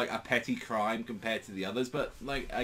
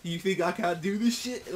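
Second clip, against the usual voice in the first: mock Bakugo taunt; 7 semitones higher, 4 dB louder than usual. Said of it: "He's like".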